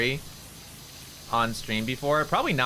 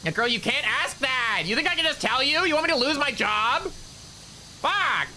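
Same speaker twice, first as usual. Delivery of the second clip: high-pitched mock outrage; exasperated scolding; 11 semitones higher than usual. Talent scolds friend for risking her job, exasperated. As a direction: squeal in indignant disbelief.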